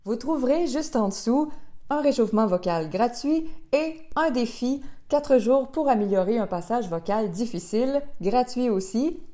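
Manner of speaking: loud, upbeat promo announcement; punchy ad read to close the video. Acted. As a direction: pitch the free offers with energy. Closing promo for free resources.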